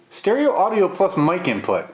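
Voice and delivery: sceptical, as if rereading the claim; incredulous. Real, not acted. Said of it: "That is an interesting claim".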